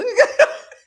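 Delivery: short, loud high laugh; quick high cackle; amused laugh. Real, not acted. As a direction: burst of real laughter. Laughing at own outburst.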